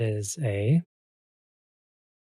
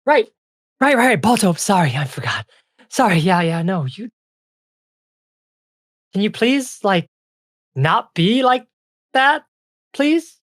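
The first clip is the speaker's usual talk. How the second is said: high, loud fairy, flustered and pleading; flustered little fairy; apologetic ice fairy; 10 semitones higher, 8 dB louder than usual. Acted. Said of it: "She's like".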